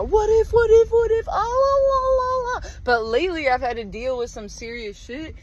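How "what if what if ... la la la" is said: high, sing-song worrier voice; anxious what-if self. Retold or imitated. Mocking her own anxious self, high and sing-song. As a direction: flutter up high like a fretful worrier. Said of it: "I've always been kinda like a".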